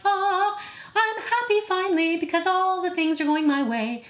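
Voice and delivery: high, lilting, dreamy parody; mock-blissful sigh of relief; sing-song mock bliss. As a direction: sing it like a daydream come true. Mock fantasy of arriving happy.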